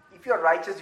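ordinary talk

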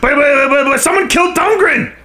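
high frantic outburst. Acted. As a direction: blurt the news in alarm. Frantic news.

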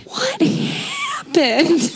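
high, drawn-out incredulous cry through a laugh; mock bafflement. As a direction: stretch it out high, aghast and amused. Acted baffled reaction to odd stranger.

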